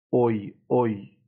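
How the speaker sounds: "اوی" sounded slowly as one blended OY; teacher isolating the OY sound "اوی"; showing the diphthong. Shown as a demonstration. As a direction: draw out the glide in "اوی". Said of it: "دوباره صدای ترکیبی اوی".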